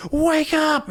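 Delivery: high, loud mock scream of wake up; wife screaming at her unconscious husband, loud and high; retold scream. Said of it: "just screams at her husband".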